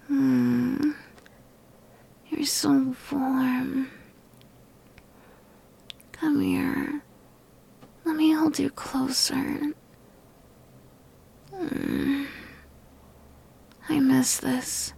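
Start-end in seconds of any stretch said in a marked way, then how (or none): none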